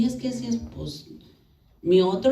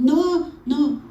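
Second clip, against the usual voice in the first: high, louder mock refusal; bratty; 6 semitones higher, 5 dB louder than usual. Daughter-in-law turning down prayer, louder retold brattiness. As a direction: cut him off petulantly. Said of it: "yo era malcriada".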